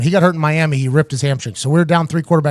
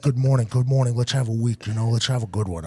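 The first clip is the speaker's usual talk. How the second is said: low, soft coach voice; imitating the coach; 4 semitones lower, 8 dB quieter than usual. Coach greeting the quarterback before team meeting, low and casual. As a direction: play the coach, quiet and low. Acts coach brushing past him.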